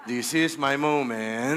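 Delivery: mock dramatic. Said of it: "Di, joke lang".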